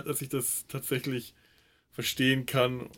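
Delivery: ordinary talk, nothing marked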